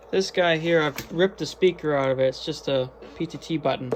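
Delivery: usual speaking voice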